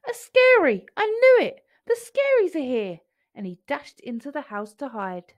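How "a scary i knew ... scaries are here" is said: high, loud panicky cry; scared little monster crying out; panicky alarm. Acted. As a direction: sound the alarm in a fright. Oogle panics at the scary.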